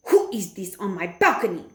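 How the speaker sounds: strange witch voice shouting. A narrator's reading. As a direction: bark it like a menacing witch. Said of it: "she shouted".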